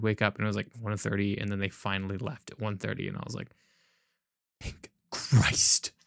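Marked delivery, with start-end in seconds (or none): 4.6-5.9 breathy whispered thank Christ; whispered relief. Retold or imitated. Retold relief when party left.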